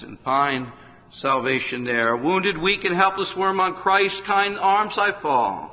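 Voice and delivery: slow, lofty hymn verse, rising and loud; preacher reciting a hymn to sinners; hymn recitation. Acted. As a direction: declaim the old hymn with fervor.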